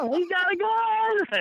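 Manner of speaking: slow, shrieking woman's alarm; imitating a terrified woman crying out; hollering woman. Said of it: "and she hollering".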